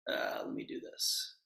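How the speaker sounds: quick hushed aside to himself; whispered aside. Real, not acted. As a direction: drop to a whisper, off-mic. Aside before playing a clip.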